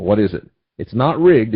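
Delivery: usual speaking voice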